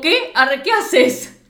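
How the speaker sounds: high, loud, slow startled cry; mock shocked squeal. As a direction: act stunned by the move. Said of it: "es como".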